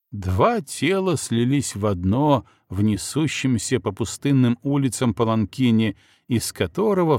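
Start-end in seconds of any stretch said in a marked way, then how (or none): none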